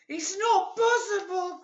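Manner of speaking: put-on high, squeaky worried old woman; crying Missus Twit. A narrator's reading. Frightened wife crying out, high and squeaky. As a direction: cry out in alarm like an old hag. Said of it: "cried Missus Twit".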